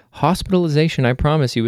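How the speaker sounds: usual speaking voice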